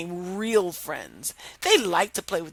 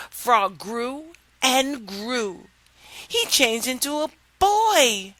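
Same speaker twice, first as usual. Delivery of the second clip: ordinary delivery, nothing marked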